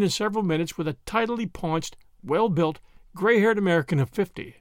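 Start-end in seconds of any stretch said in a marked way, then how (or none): none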